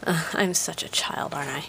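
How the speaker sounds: hushed breathy aside; sheepish whispered aside. Real, not acted. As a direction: whisper it like a guilty confession. Self-deprecating aside, unvoiced.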